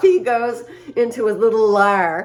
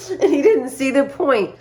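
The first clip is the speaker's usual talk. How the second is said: ordinary delivery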